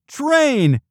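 put-on high, loud kid's shout; excited high-pitched shout. A narrator's reading. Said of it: "Spud shouted".

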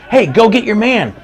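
high, loud coaching shout; dad yelling to his son mid-game; sideline call. Retold or imitated. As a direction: bark an order to your player.

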